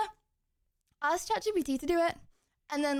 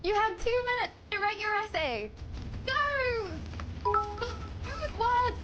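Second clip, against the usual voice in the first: hyped up, higher pitch; high cheering voice; 10 semitones higher than usual. Acted. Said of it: "cheering on Stevie's essay".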